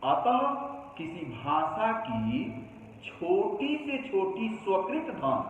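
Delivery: ordinary talk, nothing marked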